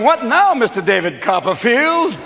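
high jeer, slowing on the name; mocking challenge. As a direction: needle him at a high pitch. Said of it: "and then they're going".

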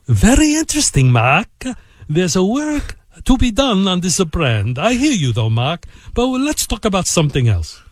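put-on Italian accent with big pitch swings. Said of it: "with his unique Italian accent".

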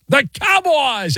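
high, slow announcer call of the team name; host calling out the team name. Acted. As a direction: boom it out like a stadium announcer.

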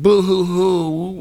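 drawn-out mock boo-hoo; stretched-out pretend sobbing; mock crying. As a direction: wail a fake, slow boo-hoo to ridicule crybabies. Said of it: "with their tears".

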